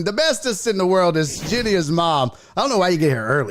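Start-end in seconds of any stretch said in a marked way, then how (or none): 0.0-2.3 announcer intro. Shown as a demonstration. Host to viewers, introducing his mom with announcer hype. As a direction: present her like a show host. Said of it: "I'm gonna give her a proper introduction".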